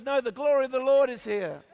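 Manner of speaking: hammed-up religious fervour; fervent mother. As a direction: declare it like a mother carried away in worship. Mother refusing to stop, acted.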